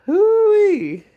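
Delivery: very high, loud hoo-wee whoop. Real, not acted. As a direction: let out a high delighted whoop. Exclamation recalling the memory.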